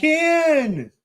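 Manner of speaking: put-on very high squeaky child call; toddler calling Ken. Father imitating toddler calling his name, very high squeak. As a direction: squeal the name like a tiny girl. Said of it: "Then it's".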